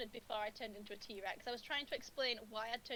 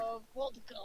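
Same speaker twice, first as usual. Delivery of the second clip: put-on Russian accent; 2 semitones higher than usual. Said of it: "But in a Russian accent".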